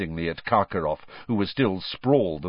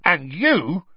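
angry, raised accusation; sudden, higher angry address; Hagrid; 8 semitones higher, 4 dB louder than usual. A narrator's reading. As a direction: turn on him angrily. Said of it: "Hagrid suddenly said angrily".

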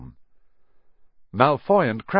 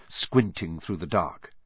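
plain reading voice

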